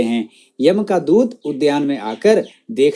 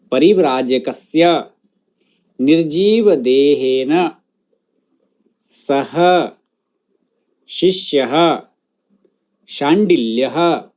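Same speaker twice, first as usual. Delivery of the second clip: word-by-word model. Shown as a demonstration. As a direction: pronounce each word separately for students to echo. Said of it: "मेरे बाद उस शब्द का उच्चारण".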